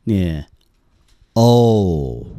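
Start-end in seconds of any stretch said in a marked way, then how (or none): 1.3-2.2 long O with lips pushed slightly forward; "O", pouted lips, drawn out as one sound; rounded long O. Shown as a demonstration. Teacher demonstrating the phonetic sound O to learners, rounded long O. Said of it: "嘴巴向前撅一些".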